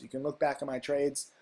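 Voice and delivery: usual speaking voice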